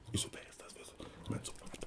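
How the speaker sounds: quick whispered question and answer; hushed aside. Retold or imitated. Artist asks manager who is calling, whispered. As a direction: whisper so the caller can't hear.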